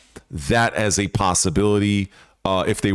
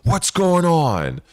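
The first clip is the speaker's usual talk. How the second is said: surprised; 5 semitones higher than usual. Retold or imitated. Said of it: "And everybody is surprised".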